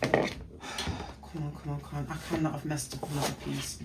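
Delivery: sighing, muttered come on under the breath; quiet fast grumble at a stuck sticker; frustrated muttering. Real, not acted. Vlogger peeling a sticker, mutters at herself under her breath.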